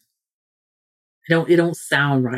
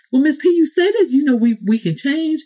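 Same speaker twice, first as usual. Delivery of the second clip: high objecting listener voice; 9 semitones higher than usual. Retold or imitated.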